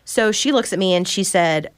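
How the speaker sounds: ordinary talk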